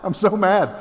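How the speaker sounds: high, loud comic yelling; mock angry rioter. Rioters yelling without knowing why, comic shouting. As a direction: ham up a clueless angry mob. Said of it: "this is hilarious".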